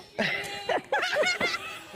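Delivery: burst of laughter. Real, not acted. Teasing question about love.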